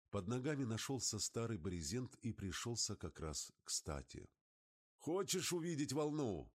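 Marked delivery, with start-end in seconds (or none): 5.0-6.5 high, raised-voice question; eager high call to a passenger. A narrator's reading. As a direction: call it across the cockpit, pitched high. Pilot calling out to passenger.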